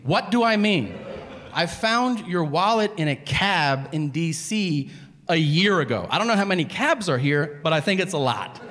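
indignant, worked-up tirade; exasperated rant. Retold or imitated. Diner lectures unimpressed waiter on the wallet search, exasperated. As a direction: pile up the proof in growing disbelief.

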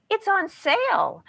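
"it's on sale" whined high and slow; whining. Shown as a demonstration. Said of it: "Our voice goes up. We start to whine".

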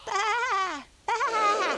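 high-pitched little lamb baas; high lamb bleats. A narrator's reading. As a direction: bleat high, like small lambs calling. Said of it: "in their high, soft voices".

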